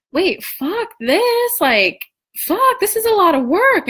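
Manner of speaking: retold frustrated outburst; exasperated outburst.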